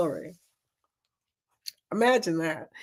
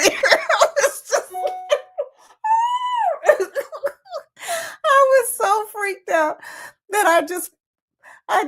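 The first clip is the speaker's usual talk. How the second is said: high-pitched panicked laughing; squealing in real fright; freaked out. Real, not acted. Freaked out by snake movie.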